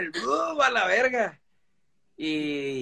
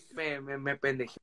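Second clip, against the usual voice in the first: ordinary talk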